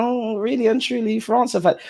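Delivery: a hint of a disappointed fan; lightly voiced disappointment. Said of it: "I'm like".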